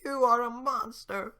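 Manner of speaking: high, slow squeaky cookie voice; gingerbread man voice. Acted. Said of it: "That's the gingerbread man's voice for now".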